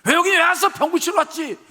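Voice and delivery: high, loud, heated answer; indignant retort. Acted. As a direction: snap back as the offended general. Acted quarrel between general and servants. (발끈해서 되받아치는 목소리)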